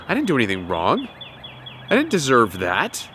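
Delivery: quietly convince yourself you're blameless; calm self-assurance. Shown as a demonstration. Abused person calmly reassuring themself, soft and low. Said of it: "develop this mindset".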